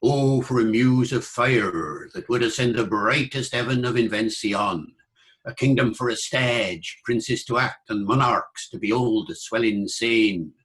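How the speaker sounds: Early Modern English accent, R sounded after vowels; verse in reconstructed Elizabethan original pronunciation. Shown as a demonstration. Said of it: "in original pronunciation, it would be".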